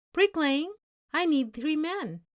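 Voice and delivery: called out loud across the lot; calling out. A narrator's reading. Truck driver calling out to the waiting men, loud. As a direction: call to the crowd of workers. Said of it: "he called".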